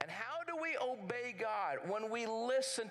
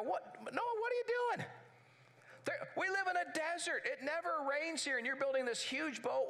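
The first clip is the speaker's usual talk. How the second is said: high, incredulous mocking voice; mocking neighbors; 6 semitones higher than usual. Neighbors mocking Noah for building a boat, high and scornful. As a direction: jeer at him as if he has lost his mind. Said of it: "laughing and mocking him".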